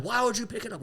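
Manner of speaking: high-pitched mock yell, no real volume; retold screaming. Golfer confronts the flag taker, high mock scream. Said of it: "We're screaming".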